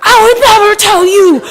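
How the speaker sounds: high and loud, half laughing, half crying; outraged but cracking up as she says it; indignant laughing refusal. Retold or imitated.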